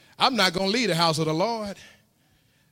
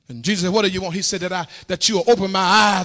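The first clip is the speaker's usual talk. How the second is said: rising, louder preaching build; 3 semitones higher, 5 dB louder than usual. Acted. Preacher builds to full-force sermon.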